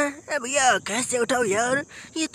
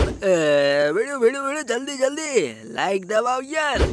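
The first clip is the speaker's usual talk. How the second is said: pushy cartoon game figure. Game character begging viewers for quick likes, pushy cartoon voice. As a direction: urge them on like a cartoon sidekick.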